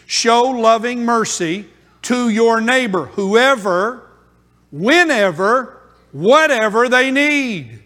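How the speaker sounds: loud, rising, drawn-out sermon call; preaching at full force. Acted. Preacher urging the church to love anyone, preaching loud. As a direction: press the command home to the church.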